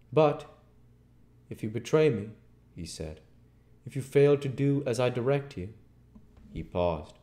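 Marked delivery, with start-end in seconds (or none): 0.1-2.3 raised, emphatic warning with pauses; threatening. A narrator's reading. Invisible man warns his helper against betrayal, threatening. 3.9-5.7 high, loud, quick warning; threatening. A narrator's reading. Invisible man warns his helper against disobeying, threatening.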